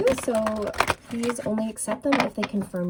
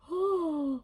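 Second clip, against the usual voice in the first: excited gasp; 8 semitones higher, 5 dB quieter than usual. Real, not acted. Delighted by confirming cards.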